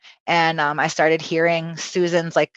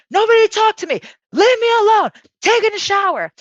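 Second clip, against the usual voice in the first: loud, high-pitched retold yell; stressed outburst; 5 dB louder than usual. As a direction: holler it to the whole house, much higher. Stressed arrival home, snapping at family.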